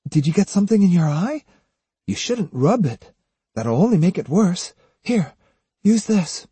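put-on high, tentative girl's voice; concerned young woman character. A narrator's reading. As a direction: offer help in a lifted, light character voice.

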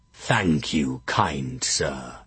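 low, oily villain's voice; evil Edmund voice. A narrator's reading. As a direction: drip evil into every word. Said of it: "in Edmund the Bastard's voice, pitch perfect, dripping with evil".

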